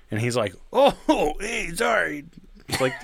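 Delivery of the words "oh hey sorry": sheepish neighbor. Retold or imitated. Neighbor startled on the stairs.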